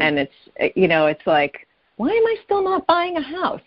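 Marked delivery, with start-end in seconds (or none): 2.0-3.6 much higher, mock-frustrated wail; exasperated self-questioning. Caller quoting her own thoughts about house-buying, exasperated. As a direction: pitch it way up, exasperated with yourself.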